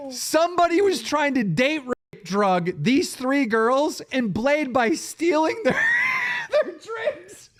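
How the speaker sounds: high voice breaking into laughter; real helpless laughter. Real, not acted. Storyteller revealing the absurd twist, overcome with laughter. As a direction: fight to finish the sentence while cracking up.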